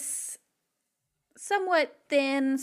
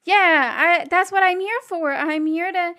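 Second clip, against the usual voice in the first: loud in character; 6 semitones higher, 6 dB louder than usual. Acted. [name] answers in character.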